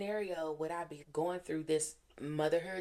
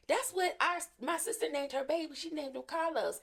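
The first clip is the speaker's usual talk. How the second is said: much higher, eager imitation of the daughter; excited reveal; 8 semitones higher than usual. Middle daughter telling mom about the baby's name, excited. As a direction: blurt out the news, pitched up high.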